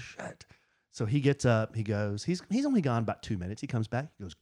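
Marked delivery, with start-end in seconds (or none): 0.0-0.5 very soft retold curse; deflated. Man retelling a setback at the DMV, deflated and hushed. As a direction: let it drop under the breath. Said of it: "positivity meter is going down".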